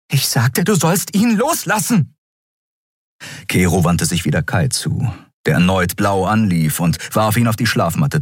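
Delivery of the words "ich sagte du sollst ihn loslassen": high, insistent command; stern order. A narrator's reading. Endriel repeats her order to release him, insistent. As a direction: repeat it and brook no argument.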